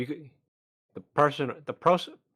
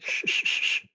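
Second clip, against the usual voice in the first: short repeated hissed "shh" with a whistle; whistling "shh". Shown as a demonstration. Player teaching the accent's whistle, hissing "shh". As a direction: whistly hush through bucked teeth. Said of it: "a bit of a whistle".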